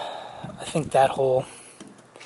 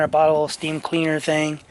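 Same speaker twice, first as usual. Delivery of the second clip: ordinary talk, nothing marked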